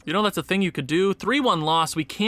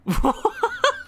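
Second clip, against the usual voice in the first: high, incredulous burst of laughter; exasperated laugh. Real, not acted. Gamer laughing at his losing team, exasperated high laugh. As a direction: laugh out of sheer disbelief.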